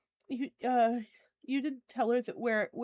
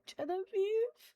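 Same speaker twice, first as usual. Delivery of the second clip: put-on crying child calling; 9 semitones higher, slower than usual. GM voices sobbing boy calling.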